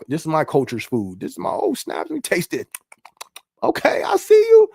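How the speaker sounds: high, squealing delight at the taste; a playful food-lover crying out; excited foodie voice. Pretend. Voicing someone tasting food.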